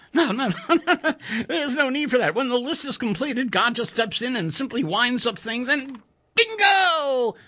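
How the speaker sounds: high, giggling through the no's; amused engineer laughing off suicide; laughing Chuck. A narrator's reading. Narrator voices Chuck laughing.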